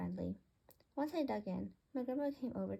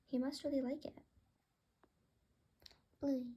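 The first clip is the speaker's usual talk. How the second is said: ordinary delivery, nothing marked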